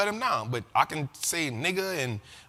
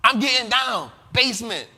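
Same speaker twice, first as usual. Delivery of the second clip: loud punchy [name]-style one-word tags; imitating [name]; 5 semitones higher, 5 dB louder than usual. Host mimicking [name]'s old catchphrase lines, loud and punchy. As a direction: snap each line then drop the tag word. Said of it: "You remember that version of [name]?".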